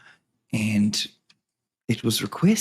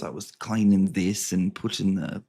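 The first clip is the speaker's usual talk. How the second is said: ordinary talk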